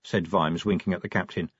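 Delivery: ordinary delivery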